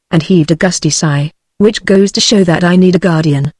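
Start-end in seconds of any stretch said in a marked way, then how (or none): none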